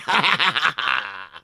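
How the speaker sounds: gleeful cackle; a smug laugh at his joke; villain laugh. A narrator's reading. Voldemort laughs at own joke.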